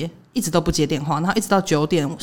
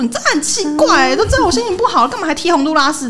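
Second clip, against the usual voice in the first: higher, exasperated mock complaint; mock indignant girlfriend; 10 semitones higher, 3 dB louder than usual. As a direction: whine it up high like the annoyed girlfriend. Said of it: "然后他就说".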